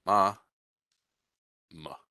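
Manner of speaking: "ma, ma" as MAH, then a short muh; strong first "ma", reduced second; reduced second vowel. Shown as a demonstration. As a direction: sound out "ma, ma" in two syllables, the last one uh. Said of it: "gets reduced and becomes an uh".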